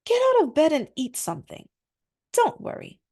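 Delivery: high, bossy wife's voice; Jezebel commanding. A narrator's reading. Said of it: "Jezebel asked".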